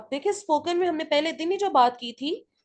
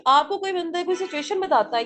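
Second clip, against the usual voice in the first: ordinary talk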